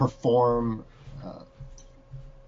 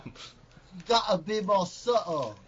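put-on Cockney accent; 4 semitones higher, slower than usual. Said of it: "It's called Cockney accent".

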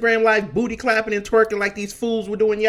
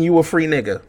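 ordinary talk

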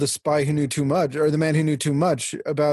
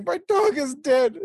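mock crying; 11 semitones higher than usual.

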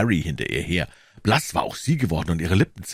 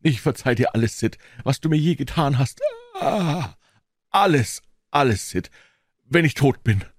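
high, pitiful deathbed voice; hammed-up suffering; groaning; 8 semitones higher than usual. A narrator's reading. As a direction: moan out a dying farewell. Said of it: "dich so stöhnen zu hören".

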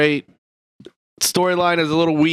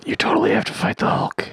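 stage whisper. Pretend. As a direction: whisper it but let everyone hear. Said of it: "Why are you whispering it to me?".

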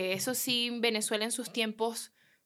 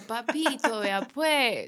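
a mock Medellín paisa lilt, a bit louder; Medellín paisa accent; 4 dB louder, much slower than usual. Venezuelan woman mimicking Medellín speech for the host, in a mock Medellín accent. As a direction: playfully imitate the Medellín way of talking. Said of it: "Hablan así como calladito".

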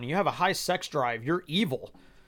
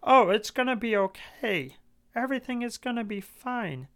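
higher, sing-song mock reassurance; imitating a soothing leader, mildly higher; 5 semitones higher than usual. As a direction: parody their fake comfort in a higher voice. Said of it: "they sit there and they say".

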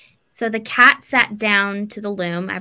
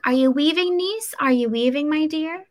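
a lightly higher, singsong witch calling; sweet-talking Baba Yaga; 8 semitones higher than usual. Retold or imitated. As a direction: call out sweetly like a scheming old aunt. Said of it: "And she's like".

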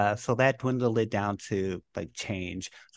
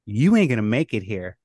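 louder mock cop telling him off; retold cop; 5 semitones higher, 10 dB louder than usual. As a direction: bark it like a cop sending him home. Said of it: "he was all like".

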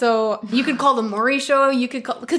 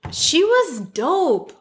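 slow, high drawn-out praise; 6 semitones higher, much slower than usual. Real, not acted. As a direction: stretch every word slowly. Fond memory emphasis.

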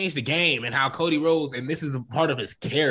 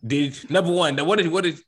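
ordinary delivery

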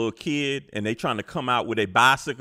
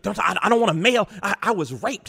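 higher, louder Karen shriek; mock Karen screaming; 9 semitones higher, 5 dB louder, faster than usual. Line-cutting woman shrieking at the man behind her.

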